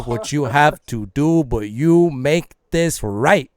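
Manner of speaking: slow, deliberate ultimatum; stern mom. Acted. Mom ordering her son to make this right, stern and slow. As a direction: lean on each word like a firm mother. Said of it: "She's like".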